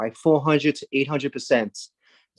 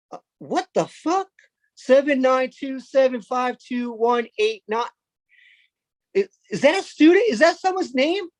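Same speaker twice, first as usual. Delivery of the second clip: high, stunned outburst; baffled at a number for a name; shocked teacher; 7 semitones higher than usual. Acted.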